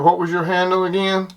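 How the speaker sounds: ordinary delivery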